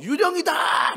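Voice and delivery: high, loud shout of alarm; crying out. Acted. Disciples seeing Jesus on the water, crying out. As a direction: yell it out at full pitch like a panicked disciple. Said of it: "막 외치는 제자들에게".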